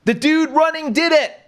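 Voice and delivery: high mock yell, pointing someone out; retold yell. Bystander filming shouts to the crowd, high mock yell. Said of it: "one of the people filming this yells".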